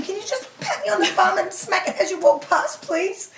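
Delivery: high, cheeky begging with a laugh; mock-sweet request to her husband, high and giggly; playful pleading. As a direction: coax him, half laughing. Asking husband for a bum smack.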